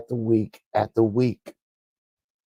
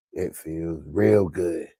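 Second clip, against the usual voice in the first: ordinary talk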